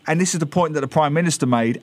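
normal talking voice

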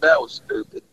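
under his breath, half-whispered self-reproach; muttered to himself. Retold or imitated. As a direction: mumble it like kicking yourself. Retold self-reproach after mistake.

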